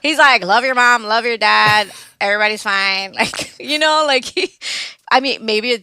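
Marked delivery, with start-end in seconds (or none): 0.4-3.1 louder, breezy easygoing stepdad voice; lightly imitating stepdad. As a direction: toss it off cheerfully, no drama here. Stepdad avoids drama, cheerful.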